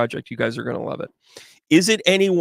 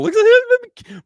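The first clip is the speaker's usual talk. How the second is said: much higher, louder, slow delighted squeal; excited squeal; 10 dB louder, slower than usual. Real, not acted. Cheering the viewer on.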